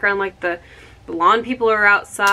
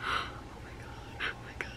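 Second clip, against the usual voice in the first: breathless gasp; 18 dB quieter than usual. Real, not acted. Gasp finding camera detail.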